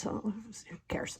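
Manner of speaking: breathy whispered shrug; unvoiced whisper to the camera; whispered aside. Real, not acted. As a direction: drop to a whisper, tossing it off. Shrugging off unmade bed aside.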